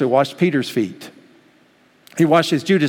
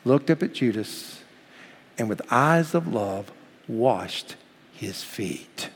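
tender; 4 semitones lower, 7 dB quieter, slower than usual. Real, not acted. Tender retelling of foot washing.

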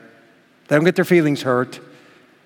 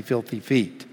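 ordinary delivery

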